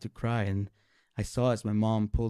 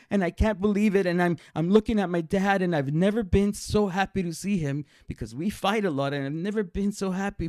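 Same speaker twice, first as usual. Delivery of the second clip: high, breathless-with-joy rush; elated, raised-pitch storytelling; overjoyed; 8 semitones higher, 3 dB louder than usual. Retold or imitated. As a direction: gush with amazed happiness. Overjoyed retelling of iguana's revival.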